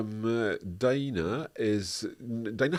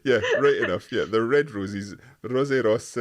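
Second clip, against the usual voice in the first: laughing; 2 semitones higher, 6 dB louder than usual. Real, not acted. Laughing at own comment mix-up.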